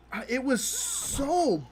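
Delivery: dismayed. Real, not acted. Slow dismayed verdict.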